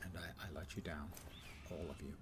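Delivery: hushed mock confession; whispering. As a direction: whisper it like a secret.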